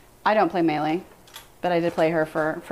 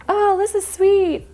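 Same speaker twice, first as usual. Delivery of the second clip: much higher, louder and slow, sugary "this is sweet"; mock sweet voice; 6 dB louder, slower than usual. Husband imitated to chat, high slow sugary coo. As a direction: coo it like a saccharine gamer. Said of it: "he yells at people now".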